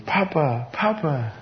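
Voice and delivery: lightly voiced granddaughter calling; toddler voice. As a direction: coo it like a toddler. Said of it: "she comes up to me".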